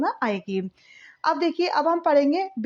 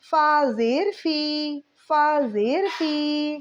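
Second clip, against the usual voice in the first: put-on each syllable drawn out slowly for children to echo; slow call-and-repeat drill; slow repeat-after-me; much slower than usual. Shown as a demonstration. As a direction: say it slowly so they can repeat. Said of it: "بولیے میرے ساتھ".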